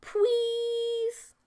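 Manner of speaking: high, drawn-out sugary plea; sweet pleading creature. A narrator's reading. Mantis girl pleads with the lonely narrator, high and sweet. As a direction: beg him sweetly, eyes wide.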